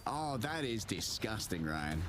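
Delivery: usual speaking voice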